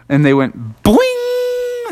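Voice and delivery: long high slow boing; springy cartoon boing; boing sound effect. Narrating the high hop.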